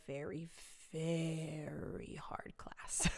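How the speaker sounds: drawn-out, breathy near-whisper; hushed emphasis. Real, not acted. Telling about mom's very hard French class, hushed emphasis. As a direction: stretch it out slowly and very softly.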